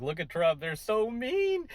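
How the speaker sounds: high, slow mock whine; mocking whiny voice. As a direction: drag out mean like a sulky critic.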